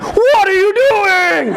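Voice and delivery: high, drawn-out inner scream; hammed-up alarmed wail; mock panic. Kid's mind protests the plan he accepted, mock panicked scream.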